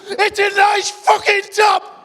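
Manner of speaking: high, loud, slow furious yell; exasperated shouting. Retold or imitated. Dad yells back at his family in slow exasperation. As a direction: bellow it, spelling out each word. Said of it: "And now you're shouting".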